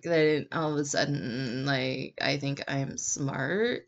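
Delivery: slow, low drawl lifting at the end; mock it slowly, tipping up at the end; growl into uptalk. Shown as a demonstration. Closet linguist mocking smart-sounding talk, growl into uptalk. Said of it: "there's the up talk at the end".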